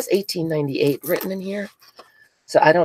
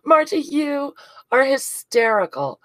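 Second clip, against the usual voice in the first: breathless laughing name, then slow words; cracking up at a friend's prank gift; 9 semitones higher than usual. Real, not acted.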